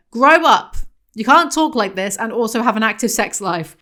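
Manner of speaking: loud and fed up, yelling at the book; exasperated shout. Real, not acted. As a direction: bark it at a grown adult. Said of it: "yelling at the character".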